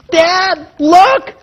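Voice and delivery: put-on high, loud little girl cry of Dad, look; imitating an elated six-year-old; excited child. Father imitating his excited daughter, high and loud.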